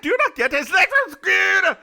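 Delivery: high, loud mock Scottish retort; Scottish man's retort. Scottish man scolding baffled listeners, loud high retort. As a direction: throw it back at them, loud and high. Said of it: "He's like".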